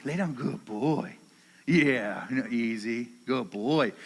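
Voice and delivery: low, soft praise; soothing a dog. Real, not acted. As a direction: settle the dog gently, voice dropped. Settling his dog.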